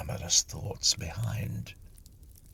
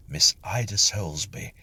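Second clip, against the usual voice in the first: plain reading voice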